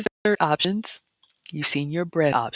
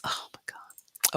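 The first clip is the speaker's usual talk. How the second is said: slow, softer whispered dismay; 8 dB quieter, slower than usual. Real, not acted. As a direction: let it fall out in a whisper.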